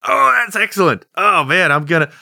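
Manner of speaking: ordinary talk, nothing marked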